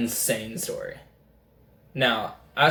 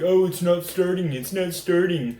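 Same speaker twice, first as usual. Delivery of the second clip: higher-pitched mock complaint; joking dad impression; 5 semitones higher than usual. Son mimicking dad's prank while fixing a car, mock worry. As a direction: put on dad's fake-trouble voice, pitched up. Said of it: "he'll be like".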